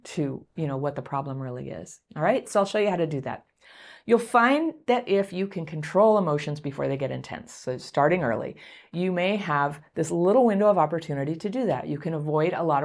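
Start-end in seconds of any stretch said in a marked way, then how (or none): none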